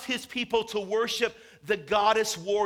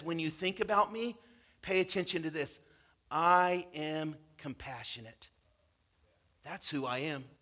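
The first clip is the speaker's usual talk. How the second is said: softer, lower, tender assurance; gentle, low; 3 semitones lower, 4 dB quieter than usual. Acted. God reassuring his people, in a soft low voice. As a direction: say it softly, like a comfort.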